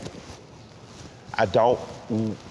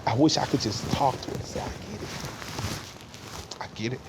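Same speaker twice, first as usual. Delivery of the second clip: choked with grief; 2 semitones higher, 7 dB quieter, faster than usual. Real, not acted. Son wishing he could speak to his late mother, choked up. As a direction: voice failing to a very soft, halting murmur.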